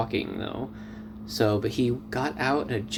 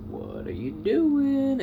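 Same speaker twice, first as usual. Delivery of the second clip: incredulous; 14 semitones higher, slower than usual. Retold or imitated. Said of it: "I'm putting my arms up like".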